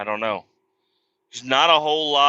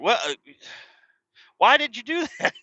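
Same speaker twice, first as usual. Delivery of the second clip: high, laughing disbelief; incredulous laugh; 11 semitones higher than usual. Retold or imitated. Husband reacting to his wife's spending, high laughing disbelief. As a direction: ask it up high with a baffled laugh. Wife spent money on insurance.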